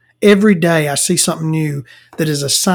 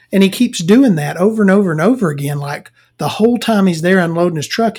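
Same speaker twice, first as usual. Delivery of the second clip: ordinary delivery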